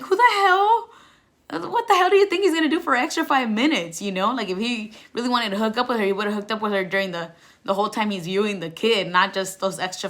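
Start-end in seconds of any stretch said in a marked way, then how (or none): 0.0-4.0 much higher, louder disbelieving shout; exasperated yell. Retold or imitated. Looking back at her own jealousy, loud disbelieving yell. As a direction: shout it at your past self. Mocking her past self.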